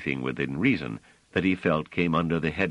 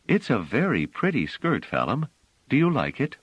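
a lightly raised, gentle woman's voice; Bliss voice; 8 semitones higher than usual. Acted. Bliss gently coaxing Phelim, lightly voiced woman. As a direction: coax kindly, a touch higher.

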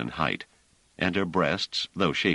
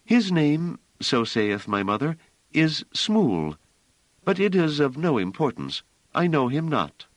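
put-on high, a bit louder, offhand young woman; indifferent Hiroko; 11 semitones higher, 4 dB louder than usual. A narrator's reading. Hiroko dismissing Pellerat's question about her father, high and offhand. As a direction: shrug off the father as nothing. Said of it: "with an indifferent shrug".

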